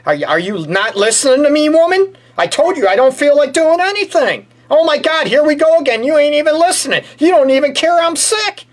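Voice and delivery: sick husband whining shrilly at his wife; whiny sick husband. Pretend. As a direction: play the sick husband whining that nobody listens.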